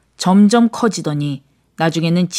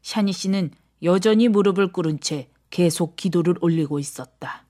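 usual speaking voice

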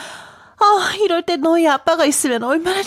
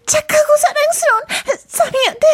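high, quick, crying coax; tearful pleading; 9 semitones higher, faster than usual. Acted. Anthea coaxing the sand fairy, tearful and high. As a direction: beg sweetly through tears.

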